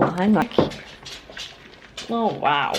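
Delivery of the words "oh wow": ordinary talk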